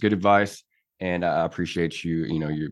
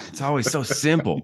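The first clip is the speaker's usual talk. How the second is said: pitched way up in mock despair; exasperated high voice; 10 semitones higher than usual. Real, not acted. Groaning at the dad joke.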